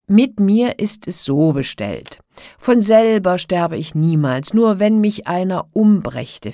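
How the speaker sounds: loud, emphatic giant voice; boastful giant. A narrator's reading. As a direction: reassure her loudly as the invincible giant. Giant explains his secret. (laut prahlender Riese)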